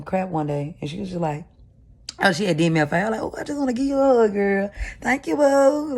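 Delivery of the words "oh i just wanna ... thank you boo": high, quick and gushing, a lightly put-on sweet girly voice; gushing sweet friend. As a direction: pour on the sugar like an overjoyed friend. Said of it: "Like that".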